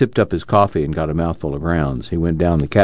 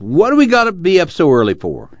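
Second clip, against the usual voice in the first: put-on much higher, whiny young man's gripe; grumbling; 13 semitones higher than usual. A narrator's reading. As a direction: gripe about the early start. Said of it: "Al grumbled".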